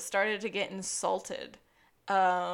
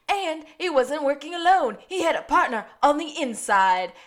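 loud, higher dramatic reveal; Gus; 5 semitones higher, 8 dB louder than usual. Acted. Podcaster quoting a TV detective's accusation, loud and dramatic. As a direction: announce it like a big revelation. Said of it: "Is it Gus again who says".

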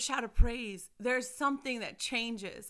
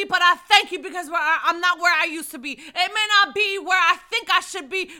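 high, loud prayer of thanks; loud praise prayer; 5 semitones higher, 12 dB louder than usual. Shown as a demonstration. Mother shows viewers how to shout praise to God. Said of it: "let out a shout of praise and said".

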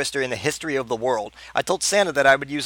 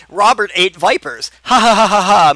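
exclaiming; 7 semitones higher, 9 dB louder than usual. A narrator's reading. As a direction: blurt it out, then burst into a loud forced cackle. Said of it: "Moopy exclaimed".

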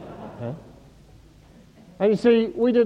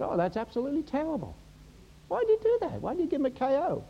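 high, fast, softer mock outrage; appalled onlooker; 9 semitones higher, 7 dB quieter, faster than usual. Beach watchers judging the lifesaver, high mock dismay. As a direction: protest in a high, hurried voice.

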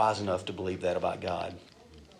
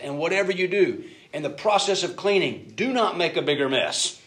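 higher, louder stern instruction; repeating the warning, a bit louder and stricter; firm dad warning; 6 semitones higher, 3 dB louder than usual. Retold or imitated. Dad warning kids again.

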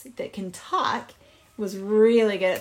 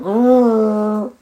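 loud, long imitated animal call; elephant trumpet; 10 dB louder than usual. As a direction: sound off like an elephant saying goodbye. Elephant says goodbye.

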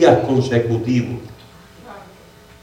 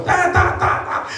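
high, repeated "ta" beeps; imitated machine alarm; 4 dB quieter, slower than usual. Preacher imitating his hospital machine, high alarm noise. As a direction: mimic a monitor going crazy.

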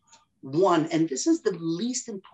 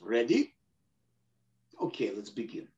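low calm voice; 8 semitones lower, 7 dB quieter than usual. Retold or imitated. Retold opponent, calm before fight.

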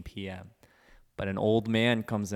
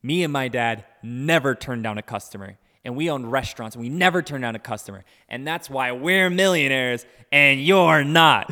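retold rant building louder, slowing on the last line; hammed-up furious customer, louder at the end; imitated angry rant; 2 semitones higher, 8 dB louder than usual. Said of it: "he goes".

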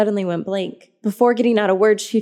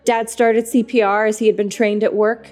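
plain reading voice

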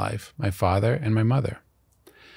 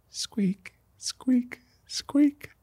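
very high, thin tricycle squeak; soft falsetto squeaks like turning wheels; imitated squeak; 5 dB quieter, much slower than usual. The strange little man rides up on a tricycle, imitated squeak. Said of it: "and I heard squeak".

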